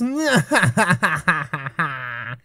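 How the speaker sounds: long gloating evil cackle; evil villain laugh. Acted. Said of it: "¿Qué opinas de mi risa malvada".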